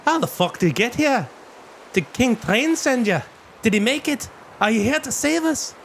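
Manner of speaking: put-on high gruff-sounding old dwarf woman. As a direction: speak up high as the weathered dwarf. GM voices the dwarf.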